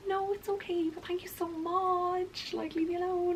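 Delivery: sweet, high polite brush-off; fake-nice decline. To the drunk guy offering a drink, high fake sweetness. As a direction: sound nice while meaning go away. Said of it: "trying to be nice".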